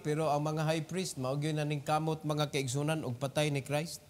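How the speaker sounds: quiet confidential commentary to the congregation; hushed aside. Real, not acted. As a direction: lean in and tell them quietly.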